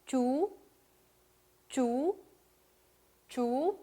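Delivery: 주 gliding upward; long 주 sweeping up at the end; rising tone. Shown as a demonstration. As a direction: lift the end of 주 like a question. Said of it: "이 모양 그대로 올려주시면 되는데".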